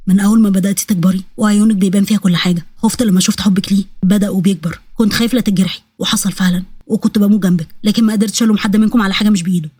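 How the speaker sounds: low, earnest fatherly voice; regretful father. Acted. Father admits his fears to his daughter, regretful. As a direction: confess it in an evenly low, serious voice.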